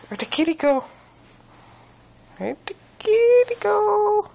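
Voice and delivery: high, loud sing-song peekaboo; talking to a toddler. Pretend. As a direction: build from playful to a big excited call. Peekaboo with cat, building.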